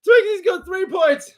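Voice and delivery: high-pitched squealing laugh, near tears; laughing so hard he can barely speak; helpless laughter. Real, not acted. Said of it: "I can't do it".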